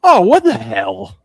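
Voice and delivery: put-on high, loud, slow little-kid exclamation; a three-year-old copying a swear; toddler voice. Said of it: "and he goes".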